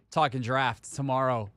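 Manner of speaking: ordinary talk, nothing marked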